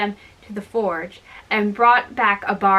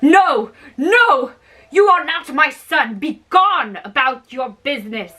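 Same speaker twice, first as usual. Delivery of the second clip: higher, louder frightened refusal; frightened cry; 3 semitones higher, 5 dB louder than usual. A narrator's reading. Frightened father crying out at the giant son, higher. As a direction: push him away in fear. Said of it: "was frightened and cried out".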